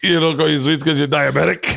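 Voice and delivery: yelled through laughter; half-crying laughing yell; laughing shout. Real, not acted. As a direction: shout it while cracking up. Punchline yelled while laughing.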